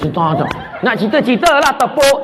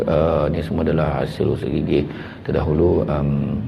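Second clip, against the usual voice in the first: put-on much lower, softer, deadpan boy interview voice; modest boy; 11 semitones lower, 6 dB quieter than usual. Boy answers the reporter about his results, low and subdued. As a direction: mumble it low like a shy schoolboy. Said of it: "Waktu wartawan tanya".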